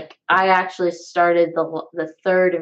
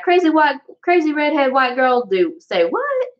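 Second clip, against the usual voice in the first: high, incredulous onlooker voice; exaggerated disbelief, pitched way up; mock disbelief; 8 semitones higher than usual. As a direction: act out an astonished onlooker. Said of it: "you're gonna look at me being like".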